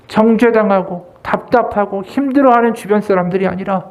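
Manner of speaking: ordinary talk, nothing marked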